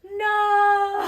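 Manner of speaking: loud, high, long "no"; hammed-up cry of dismay; horrified shout. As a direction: wail it in comic despair. Acted horror at party encounter.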